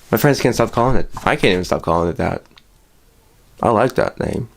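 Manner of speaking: usual speaking voice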